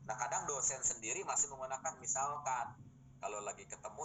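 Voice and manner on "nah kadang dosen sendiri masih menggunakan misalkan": usual speaking voice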